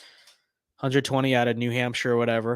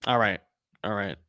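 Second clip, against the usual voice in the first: ordinary talk, nothing marked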